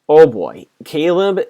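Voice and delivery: loud burst of glee at the TV; excited outburst. Retold or imitated. Fan recapping a show, cheering loudly at Caleb. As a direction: blurt it out, thrilled by the plot twist. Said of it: "I was like".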